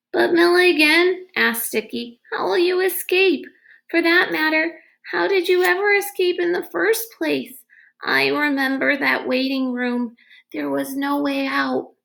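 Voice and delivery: put-on a boy pressing with questions; curious boy. A narrator's reading. Boy asking the agent how he escaped, curious.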